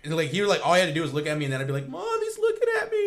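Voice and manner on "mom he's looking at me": put-on high whiny kid tattling to mom; whiny tattling little sibling. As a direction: whine it like a little kid telling on his brother.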